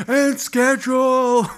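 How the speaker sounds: high-pitched drawn-out mock holler; Chris yelling. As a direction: yell it out slow and high, imitating him. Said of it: "I just heard Chris yell".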